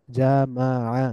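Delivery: জামাআ slowly as ja, ma, 'a; syllable by syllable. Shown as a demonstration. Teacher breaking down the word, জামাআ syllable by syllable. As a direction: split জামাআ into three drawn-out syllables. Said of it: "একটু মিলিয়ে মিলিয়ে পড়ব".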